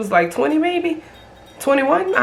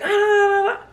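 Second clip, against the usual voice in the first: exclaim; 9 semitones higher than usual. Retold or imitated. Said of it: "she was like".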